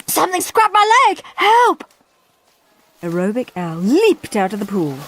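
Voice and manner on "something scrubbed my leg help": high, loud cry for help; panicked scream. A narrator's reading. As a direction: scream in terror. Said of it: "screamed Al".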